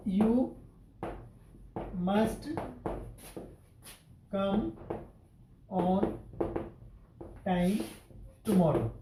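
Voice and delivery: each word set apart with long pauses; slow dictation. Shown as a demonstration. Teacher dictating an example sentence to students, slow word by word. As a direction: dictate it to the class one word at a time. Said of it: "जैसे".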